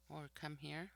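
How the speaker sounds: ordinary talk, nothing marked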